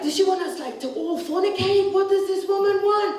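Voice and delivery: mock outraged rant, pitched high; ranting TV host. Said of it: "he just had this rant about me".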